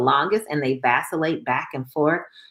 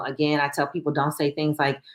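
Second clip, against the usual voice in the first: normal talking voice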